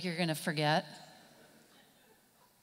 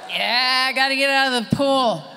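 lifeguard; 3 semitones higher, 6 dB louder than usual. Acted. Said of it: "And the lifeguard's like".

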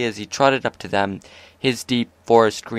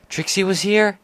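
much higher shocked question; startled; 9 semitones higher than usual. A narrator's reading. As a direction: jump in with surprise. Twilight startled by the name.

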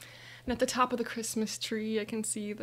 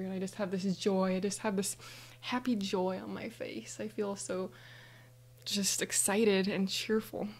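plain reading voice

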